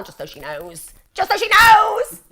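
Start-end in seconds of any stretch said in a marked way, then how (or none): 1.1-2.2 high, drawn-out yell; loud, high, slow hammed-up shout; mock shout. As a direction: bellow it slowly as a comic threat. Mock threat, repeated as a shout.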